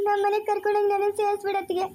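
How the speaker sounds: tearful pleading, voice breaking; crying plea. Acted. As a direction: beg for forgiveness while crying. Daughter-in-law begs forgiveness.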